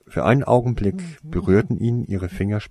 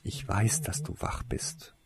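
soft, fading into a whisper; 8 semitones higher, 7 dB quieter than usual. A narrator's reading. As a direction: coax gently under the breath. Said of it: "flüsterte er". (leise geflüstert)

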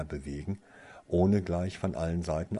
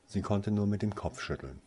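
ordinary delivery

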